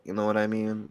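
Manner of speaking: ordinary talk, nothing marked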